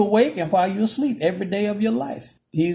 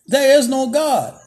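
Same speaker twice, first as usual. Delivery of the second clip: louder, higher triumphant There is no God; gloating atheist; 6 semitones higher, 6 dB louder than usual. Retold or imitated. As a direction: crow it like a mocking neighbor. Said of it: "jumps out ... and says".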